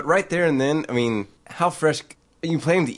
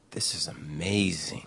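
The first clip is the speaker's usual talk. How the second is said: hushed awe; 4 semitones lower, 7 dB quieter, slower than usual. Retold or imitated. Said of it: "she tastes one".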